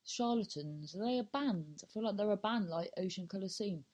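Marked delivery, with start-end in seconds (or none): none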